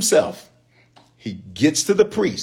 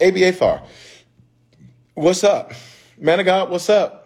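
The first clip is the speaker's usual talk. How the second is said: higher, casual hey-man call; casual street greeting; 6 semitones higher, 3 dB louder than usual. Retold or imitated. David greeting the priest like a buddy, casual high call. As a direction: hail him like an old buddy. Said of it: "He was like".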